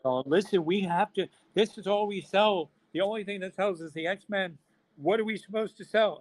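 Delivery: high, loud, exasperated pleading; retailers protesting. Retold or imitated. Retailers confronting him over their sales, exasperated protest. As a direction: plead in mock outrage, pitched well up.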